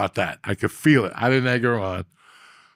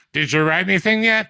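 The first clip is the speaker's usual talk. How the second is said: put-on pushy drunk lady; 7 semitones higher than usual. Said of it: "she'd go".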